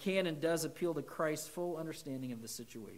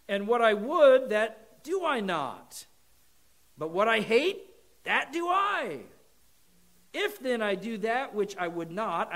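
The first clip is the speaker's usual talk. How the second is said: raised, louder, emphatic reading; scripture reading; 4 semitones higher, 7 dB louder than usual. Acted. Preacher reading Romans to the congregation, emphatic. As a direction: proclaim the passage with rising intensity.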